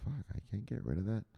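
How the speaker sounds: very soft quick grumble to himself; muttered under breath. Real, not acted. Fighting the chart labels, a soft quick aside. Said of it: "Let me just see if I can get rid of this".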